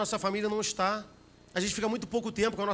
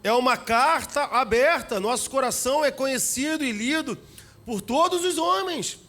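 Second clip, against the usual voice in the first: higher, louder pulpit questions; rising preaching; 4 semitones higher, 5 dB louder than usual. Acted. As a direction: press the congregation. Preaching at full force.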